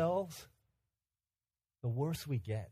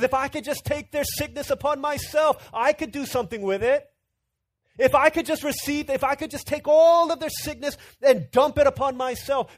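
much higher, much louder desperate plea; pleading cry; 10 semitones higher, 10 dB louder than usual. Retold or imitated. Preacher voicing a parent of a sick child, crying out.

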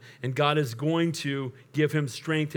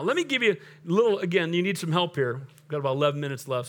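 normal talking voice

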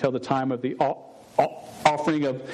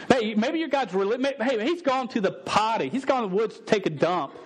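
high, playful mocking jeer; mocking taunt; 7 semitones higher than usual. Preacher taunting Baal's prophets as Elijah would. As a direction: tease them about their missing god. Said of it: "he mocks them".

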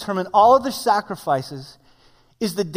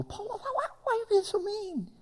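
much higher, soft, whining voice; whiny high pleading; 12 semitones higher, 10 dB quieter than usual. Pretend. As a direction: plead like a hurt child. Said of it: "you look at it in Paul and go".

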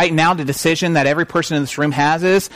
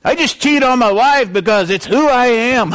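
put-on country accent; 7 semitones higher, 3 dB louder than usual. Preacher mimicking people who excuse cheating, country accent. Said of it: "he doesn't have to have a country accent".

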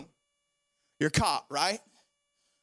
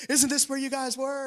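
higher-pitched worried mom, retold; alarmed question in a raised pitch, acted; freaking-out mom; 7 semitones higher than usual. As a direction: imitate a mother mildly freaking out. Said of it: "she's freaking out".

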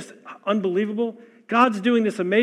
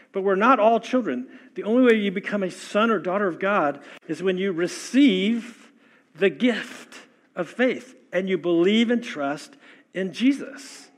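usual speaking voice

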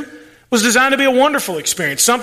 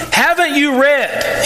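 slow, higher, pointed sarcasm; sarcastic Jesus; 6 semitones higher, slower than usual. Retold or imitated. Preacher voicing Jesus mocking the Pharisees, sarcastic. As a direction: draw it out like an obvious jab. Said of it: "he's sarcastic".

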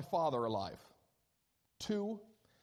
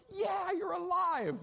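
high, slow, hammed-up glad reply; mock cheerful; 13 semitones higher, 3 dB louder, slower than usual. Pastor mocking a cheerful reply the brothers never gave. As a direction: sing it out like happy brothers. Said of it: "It's not like they went".

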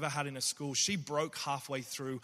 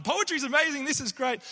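much higher, louder, bubbly enthusiastic poet; jovial poet; 12 semitones higher, 7 dB louder than usual. Retold or imitated. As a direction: gush like a fun-loving visiting poet. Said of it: "coming in as the jovial poet and the having fun".

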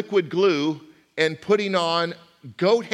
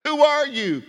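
high, loud "Who are you?" with "are" punched; pressing question; 11 semitones higher, 5 dB louder than usual. Shown as a demonstration. Preacher repeatedly pressing the congregation: who are you. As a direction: demand it with "are" stressed. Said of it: "If I were to say".

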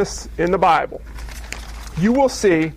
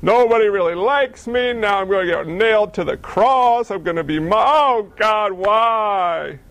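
mock despairing Jesus; 2 semitones higher, slower than usual. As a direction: play it as a melodramatic wail. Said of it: "Could you see Jesus in the Garden".

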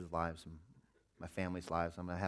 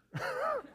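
amused high laugh; high burst of laughter. Real, not acted. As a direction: laugh at the cheeky reply. Son's cheeky answer.

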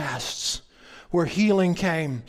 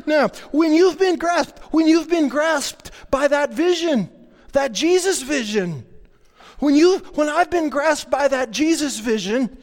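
higher and louder, building fervor; getting excited; 8 semitones higher, 5 dB louder than usual. Real, not acted. Pastor carried away preaching the Jesus vision, excited. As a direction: let the excitement run away with you. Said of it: "okay, I'm getting excited".

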